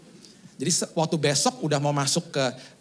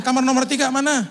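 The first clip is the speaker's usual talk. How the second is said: high, louder hurried demand, stress on tiga; loud frantic asking; 10 semitones higher, 6 dB louder than usual. Retold or imitated. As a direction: bark it out while hurrying. Rushing through hospital again. (bertanya keras dan tergesa-gesa, tekanan pada "tiga")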